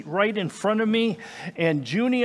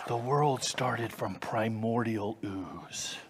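mock quote; 6 semitones lower, 7 dB quieter, slower than usual. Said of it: "so they say".

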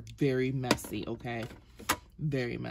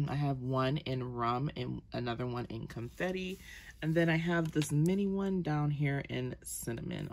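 normal talking voice

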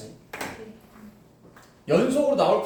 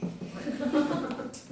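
quiet, high, breathy giggle; laugh; 7 semitones higher, 7 dB quieter than usual. Real, not acted. Teacher to class after a slip, quiet high giggle. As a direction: giggle softly, mostly on breath.

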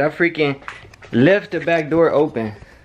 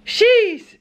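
squeaked sheesh of relief. Real, not acted. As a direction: blurt out a high sheesh. Relief after scare.

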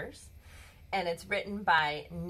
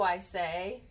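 ordinary delivery

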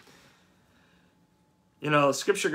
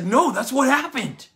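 defensive, digging in hard; retold flare of anger, insisting; getting angry; 9 semitones higher, 5 dB louder than usual. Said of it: "you just start getting more angry".

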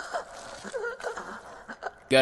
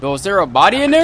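alarmed; 5 semitones higher, 9 dB louder, faster than usual. Real, not acted. Spots a body, alarmed.

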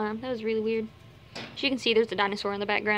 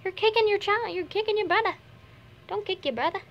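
higher, playful telling-off; mock scolding; 9 semitones higher than usual. Real, not acted. Vlogger scolding a bunny for kicking its brother.

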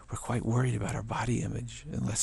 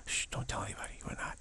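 much softer, whispered shushing; hushed secret; 8 dB quieter than usual. Pretend. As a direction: keep it secret between us. Conspiratorial whispered aside.

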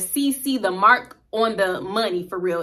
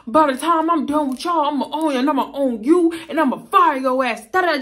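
high, louder mock bluster; acting outraged; 5 semitones higher, 4 dB louder than usual. Customer vows to own the Popeyes, loud mock outrage. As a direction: boast like a furious customer. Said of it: "he acting so outraged".

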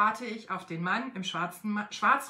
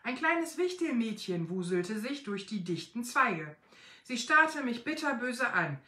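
plain reading voice

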